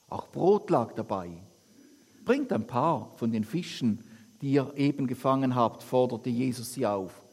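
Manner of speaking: lively, lightly acted Bible reading to the congregation; scripture reading. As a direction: read the Gospel aloud with the quotes lightly voiced. Reads Bible passage aloud. (lebhafte Bibellesung)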